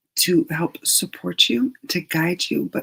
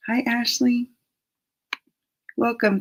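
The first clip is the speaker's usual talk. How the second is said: usual speaking voice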